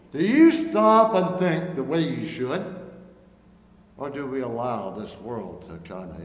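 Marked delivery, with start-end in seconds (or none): 0.1-2.8 preaching. Acted. Preacher's pointed rhetorical challenge.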